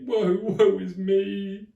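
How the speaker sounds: very loud, slow, high wailing woe; melodramatic lament. Acted. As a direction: ham up the tragedy.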